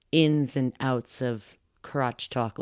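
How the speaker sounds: ordinary delivery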